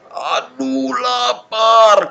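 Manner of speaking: drawn-out "oh no, so hungry" moan; hungry groan.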